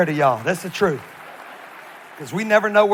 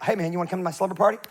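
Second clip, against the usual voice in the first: mock invite; much faster than usual. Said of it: "I mean, like".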